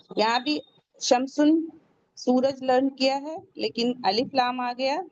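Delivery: usual speaking voice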